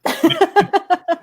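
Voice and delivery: high loud laugh bursting out; burst of laughter. Real, not acted. Laughs at the story.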